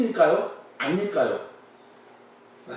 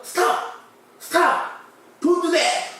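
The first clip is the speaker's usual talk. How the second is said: panicked shout; 9 semitones higher, much slower than usual. Acted. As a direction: shout to stop him in alarm. Scientist panics at red pen. (다급하게 외치는 고함)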